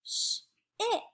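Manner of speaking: "Ship" split as a long SH, pause, then IP; segmented word. Shown as a demonstration. Teacher sounding out the first picture, segmented. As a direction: hold the SH of "Ship", then add IP separately. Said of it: "let's segment that".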